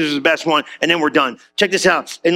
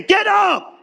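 mock command; 11 semitones higher than usual. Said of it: "I would have suplexed him".

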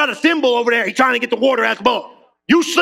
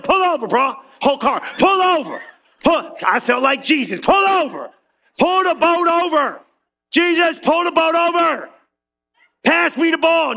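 higher, urgent calls to pull over; mock yelling; 7 semitones higher than usual. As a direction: yell at the driver to stop the car. Yelling at sleepy driver.

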